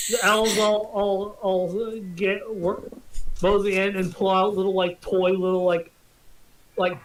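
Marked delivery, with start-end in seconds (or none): none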